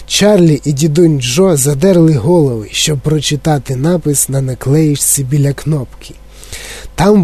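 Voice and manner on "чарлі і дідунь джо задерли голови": ordinary delivery, nothing marked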